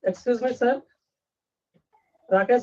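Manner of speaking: ordinary talk, nothing marked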